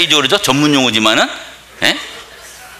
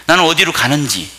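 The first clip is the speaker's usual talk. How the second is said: normal talking voice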